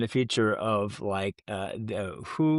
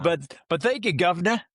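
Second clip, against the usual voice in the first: higher-pitched, hammed-up British accent; 7 semitones higher, 4 dB louder than usual. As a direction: put on a cheeky British "guv'nor" accent. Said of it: "impression there too. Good accent".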